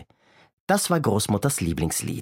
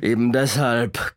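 slow, mild storybook growl; drawn-out, lightly gruff villain; growling robber; slower than usual. A narrator's reading. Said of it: "knurrte der Räuber Hotzenplotz".

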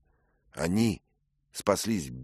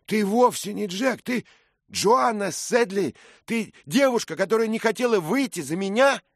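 higher, louder youth's voice in disbelief; astonished young squire; young hero stunned by a revelation; young man Dick, stunned; 7 dB louder than usual. Acted. Dick's voice, realizing the truth.

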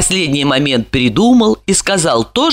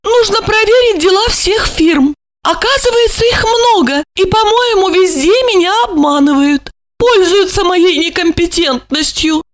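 a woman fretting about being cheated; high female character voice; complaining lady; 12 semitones higher than usual. A narrator's reading. Olga voiced by narrator.

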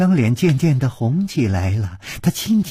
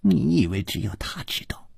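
sneering; 6 dB quieter than usual. Acted. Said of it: "冷笑着说".

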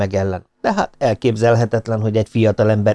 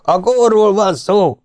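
high, loud, slow insistent yell; yelling back; 10 semitones higher, 3 dB louder, slower than usual. A narrator's reading. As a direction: hammer the point over the noise. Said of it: "ordítoztak azok".

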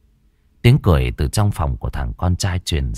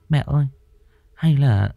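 scared pleading; 7 semitones higher than usual. Acted. Scared girl begging mother.

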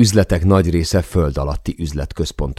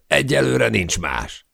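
a higher, slow, lightly voiced Will; Will calling out; 7 semitones higher, slower than usual. A narrator's reading. Will greets the balloonists at the airport, higher and slower. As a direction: hold the note of the call, slowly. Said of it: "kiáltotta Will".

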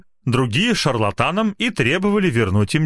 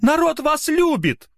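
put-on old lady cheering. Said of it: "одобряюще крикнула".

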